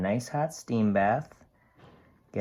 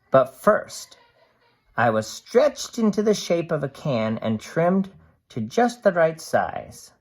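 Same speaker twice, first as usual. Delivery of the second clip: lively storybook voice for a can's diary; cheerful picture book narration; reading to children; 4 semitones higher, 5 dB louder than usual. A narrator's reading. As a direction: read it bright and animated to a class. Reads picture book aloud.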